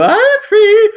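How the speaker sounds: very high, loud camp sing-out; shrieky high camp impression; Mister Humphreys. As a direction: trill it out as a camp sitcom catchphrase. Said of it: "Mister Humphreys would go".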